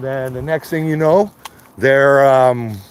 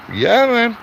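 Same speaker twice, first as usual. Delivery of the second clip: much higher, fired-up agreement; excited yeah; 11 semitones higher than usual. Real, not acted. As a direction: throw it out high and eager. Excited outburst mid rant.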